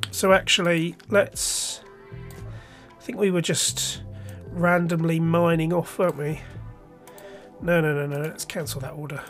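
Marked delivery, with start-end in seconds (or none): none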